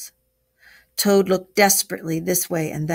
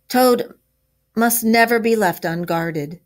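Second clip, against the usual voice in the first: louder, higher, emphatic pronouncement; firm storybook order with weighty pauses; 4 semitones higher, 5 dB louder than usual. A narrator's reading. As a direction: lay down the rule firmly. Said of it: "said the Badger firmly".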